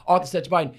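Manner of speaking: usual speaking voice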